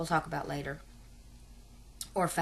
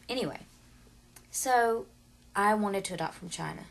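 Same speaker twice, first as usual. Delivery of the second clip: ordinary talk, nothing marked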